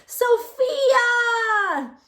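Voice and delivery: high, loud call of a name; teacher's shout. A narrator's reading. Teacher shouting at a chatting pupil, loud call. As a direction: shout the name across the classroom. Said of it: "until the teacher shouted".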